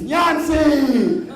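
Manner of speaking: put-on high, loud gleeful yell of a little boy; kid's excited shout. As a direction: shout it like a thrilled four-year-old.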